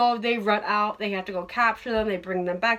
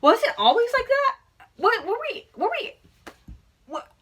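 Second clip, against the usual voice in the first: shocked disbelief; 12 semitones higher, 4 dB louder than usual. Retold or imitated. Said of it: "And I was like".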